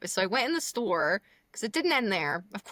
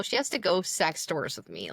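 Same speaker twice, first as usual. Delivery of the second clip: usual speaking voice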